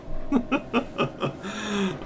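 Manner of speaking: breathy rueful chuckle; rueful laugh. Real, not acted. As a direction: laugh off the mishap. Laughing about lost audio.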